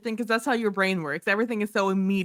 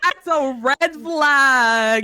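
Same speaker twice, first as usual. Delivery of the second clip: loud, drawn-out playful holler; gleeful over-the-top warning call; mock alarm; 5 semitones higher, 7 dB louder, much slower than usual. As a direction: call it out big, stretching each word. Mock warning about a line.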